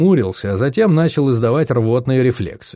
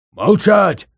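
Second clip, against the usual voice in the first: higher, louder bear roar; 7 semitones higher, 3 dB louder than usual. A narrator's reading. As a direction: bellow the order like a beast. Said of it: "взревел Угрх".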